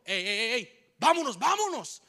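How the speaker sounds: high, loud urging to flee; mock urgent shout. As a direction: call the others off in a hurry. Assailant urging others to leave. (grito urgente para huir)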